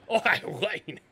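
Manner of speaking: lightly put-on Australian twang; mild mock Aussie greeting; a hint of Australian Michael Caine. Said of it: "Michael Caine as an Australian".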